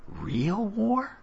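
put-on high-pitched child's question; curious boy. A narrator's reading. Boy asks his new uncle about war, high and curious. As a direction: pitch way up, wide-eyed question.

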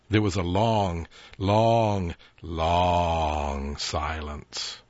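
long stretched slowly, each long dragged out; drawn-out suspense. A narrator's reading. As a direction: linger on every long to build dread.

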